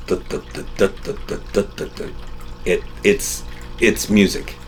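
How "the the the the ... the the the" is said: voiceless rhythmic chugging the-the-the like an engine idle; mock engine idle; imitating lumpy idle. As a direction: chug it out like a lopey cam at idle. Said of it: "the idle is just the".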